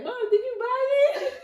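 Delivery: put-on little girl faking surprise to her mom, high pleading cry; mock innocent child. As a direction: play the sneaky kid acting innocent, near tears.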